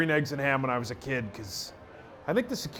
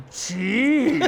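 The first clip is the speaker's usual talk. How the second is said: high, mock-appalled exclamation; raised-pitch retold dismay; imitated scolding friend; 7 semitones higher, 3 dB louder than usual. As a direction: mimic her shocked reproach. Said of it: "and she goes".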